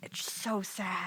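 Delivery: soft, slow whisper; hushed lament. Real, not acted. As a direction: sigh it out under your breath. Lamenting the fallen voice actor.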